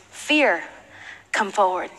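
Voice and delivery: low, hushed call to battle; a hint of a grave king, soft and falling; imagined king's summons. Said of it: "Maybe King David called his name".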